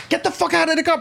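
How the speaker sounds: high, pushy cop barking an order; mock cop yelling. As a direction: bark it like an angry cop pulling you out. Cop ordering him out, retold.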